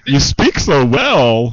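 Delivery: slow, drawn-out mock praise; mock compliment. Parroting backhanded praise about someone's speech, slow mock admiration. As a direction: stretch it out in exaggerated admiration.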